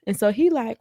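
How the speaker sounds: usual speaking voice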